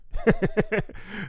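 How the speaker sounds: loud, high burst of laughter. Real, not acted. Comedian laughing at his own punchline, loud and high. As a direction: crack up at the punchline.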